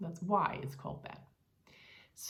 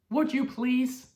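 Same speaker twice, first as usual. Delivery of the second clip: louder, slow, pointed Would you please; curt, irritated request; 3 semitones higher, 7 dB louder, slower than usual. Shown as a demonstration. Teacher demonstrating an impolite, annoyed request. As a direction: make please sound like an order, not a favor. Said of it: "It's actually just a little angry".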